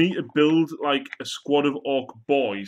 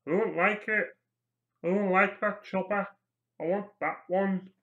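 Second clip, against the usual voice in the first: lightly voiced orc, pitched up; 6 semitones higher than usual. Orc grumbling about a chopper, higher put-on voice.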